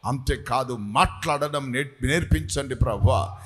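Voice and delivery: high, loud, building intercession; fervent shouted prayer. Real, not acted. Pastor cries out to God for fire and boldness in a loud prayer.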